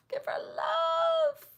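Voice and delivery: squeaky high mock sob on give her love; mock crying plea. To viewers, begging them to support Katie, in a mock sob. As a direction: whimper it like a fake cry.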